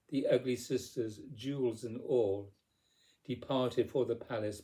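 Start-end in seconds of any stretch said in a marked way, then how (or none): none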